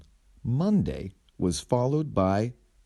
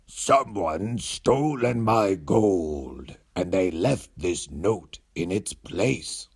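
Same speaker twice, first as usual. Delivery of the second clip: very slow, lightly voiced dragon; a mild upset dragon dragging words; slower than usual. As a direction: dragon showing the note, mildly distressed. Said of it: "he cried".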